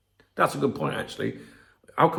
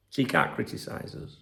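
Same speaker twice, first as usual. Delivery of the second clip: normal talking voice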